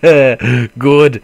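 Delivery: very loud sudden relieved good; relieved outburst. Real, not acted. Gamer relieved the monsters missed him, loud burst. Relief enemies didn't see.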